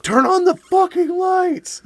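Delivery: high, drawn-out mock scream; retold waking scream. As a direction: stretch it out like a panicked yell. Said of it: "I just wake up fucking screaming".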